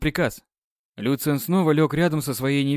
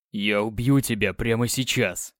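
growling threat; 4 semitones lower than usual. Acted. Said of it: "рычал он".

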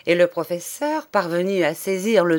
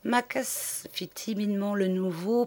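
ordinary delivery, nothing marked

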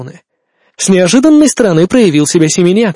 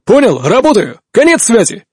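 over walkie talkie; 5 semitones higher than usual. Acted. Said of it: "Этот бодрый, почти весёлый голос".

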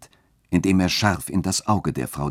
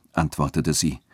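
ordinary delivery

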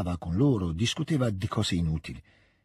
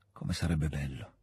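much lower and softer, a longing sigh; wistful murmur; 8 semitones lower, 8 dB quieter than usual. Acted. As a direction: breathe it out like a dying man's wish. Dying prince's soft longing. (sussurro malinconico, molto più basso e sommesso)

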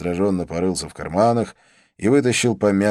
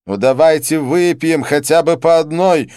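loud, high-pitched plea; theatrical begging, high and loud; pleading; 9 semitones higher, 9 dB louder than usual. A narrator's reading. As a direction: beg loudly for just one drink. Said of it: "взмолился Дидье".

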